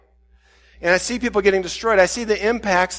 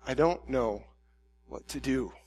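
quiet prayer; 5 semitones lower, 6 dB quieter, slower than usual. Acted.

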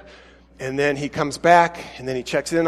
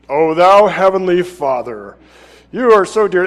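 mock pompous prayer; 6 dB louder than usual. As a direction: ham up a showy churchy prayer. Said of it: "I can't even talk that way hardly".

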